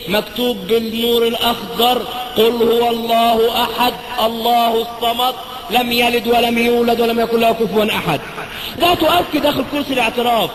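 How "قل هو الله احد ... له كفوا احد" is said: very slow, measured recitation; slow Quran recitation. Acted. Priest reading the verse glowing on the curtain, slow reverent recitation. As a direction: intone each phrase reverently.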